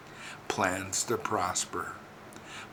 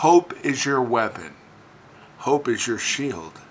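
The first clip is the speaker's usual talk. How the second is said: louder, emphatic preacher's rallying cry; forceful preaching; 2 semitones higher, 6 dB louder than usual. Real, not acted. Rallying believers to fight back with hope, forceful preaching. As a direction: preach it with force, punching key words.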